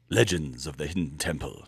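announcer voice. Retold or imitated. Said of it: "sounds like you're about to say".

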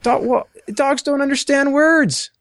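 exasperated high-pitched objection; excited high protest. Real, not acted. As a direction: push back, voice jumping high. Heated objection in debate.

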